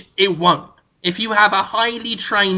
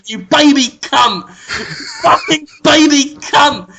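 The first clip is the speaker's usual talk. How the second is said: laughing mock insult, higher and slow; mock shouting; 6 semitones higher, 4 dB louder, slower than usual. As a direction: hurl it like a half-laughing taunt.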